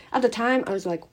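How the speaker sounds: ordinary talk, nothing marked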